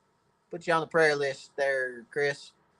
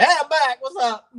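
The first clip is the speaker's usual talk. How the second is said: higher, louder happy greeting; cheerful comeback; 11 semitones higher, 6 dB louder than usual. Retold or imitated. Recovered husband announcing he's back, bright and loud. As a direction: bounce back in like nothing happened. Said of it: "I'm like".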